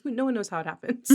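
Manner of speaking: lightly voiced, fast, awestruck quote; a light touch of wide-eyed Arthur; wondering Arthur quote. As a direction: say it with childlike wonder, quickly. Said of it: "looks at the magic of flight ... and goes".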